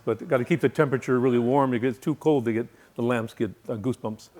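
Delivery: ordinary talk, nothing marked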